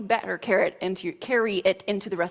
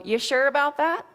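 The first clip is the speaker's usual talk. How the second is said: higher-pitched sceptical challenge, acted; doubting inner voice; 5 semitones higher, 4 dB louder than usual. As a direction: question it like a nagging doubt.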